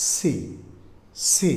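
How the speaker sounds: "সি" with air hissed on the s; airy C. Shown as a demonstration. Teacher shows air in the C sound, hissed start. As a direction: let a little air out before the ee. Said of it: "সাউন্ডের একটু হালকা বাতাসও আসতে পারে".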